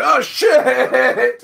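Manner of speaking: high, wavering "oh shit" cry; hammed-up cry of alarm; acted startled yelp. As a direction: yelp it in mock alarm, drawing out the swear. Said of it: "I go".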